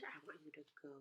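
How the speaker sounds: whispered instruction; hushed classroom aside. Real, not acted. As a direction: softly tell the class. Teacher instruction.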